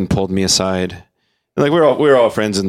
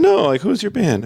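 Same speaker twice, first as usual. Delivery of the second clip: much higher insistent mock voice; quoting Michael; 13 semitones higher than usual. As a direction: push the question up high, lightly mocking.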